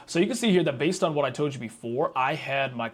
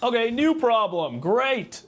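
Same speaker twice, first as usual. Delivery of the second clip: sarcastic; 9 semitones higher, 6 dB louder, much slower than usual. Real, not acted. Another error appears.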